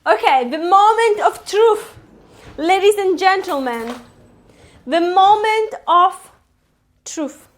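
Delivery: playful showman build-up; mock announcer. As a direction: announce it like a game show host.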